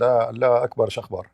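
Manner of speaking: normal talking voice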